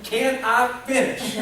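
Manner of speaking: slow, high, loud laughing mock Perot; Ross Perot impression. Kid as Ross Perot protests to dad, loud and slow. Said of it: "I'm like Ross Perot".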